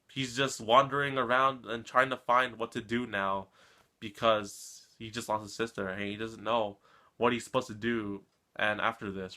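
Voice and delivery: ordinary delivery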